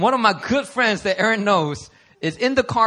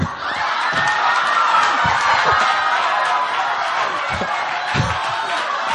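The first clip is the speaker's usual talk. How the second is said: laughing; 4 dB louder than usual. Real, not acted. Shared joke, long laugh.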